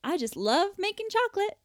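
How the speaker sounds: mock-perky little sales line; gushing log line. Rehearsing a stock answer about the job, gushing. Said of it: "log line that's just like".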